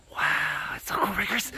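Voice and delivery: hushed whisper. Real, not acted. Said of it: "I feel like I have to whisper".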